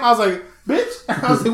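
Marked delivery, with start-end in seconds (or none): 0.7-1.0 very high-pitched comic exclamation; high, indignant yelp; retold disbelief. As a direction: squeal it in mock disbelief. Retells reaction to losing fries.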